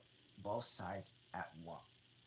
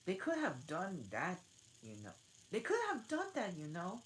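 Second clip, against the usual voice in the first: higher, lightly voiced girl; gently remarking; 10 semitones higher, 6 dB louder than usual. Alice gently objecting to the Dormouse, higher. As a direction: point it out gently. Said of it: "gently remarked".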